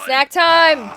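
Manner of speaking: snack time sung out high and loud; loud sing-song call. Pretend. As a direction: call it out like a cheerful announcement.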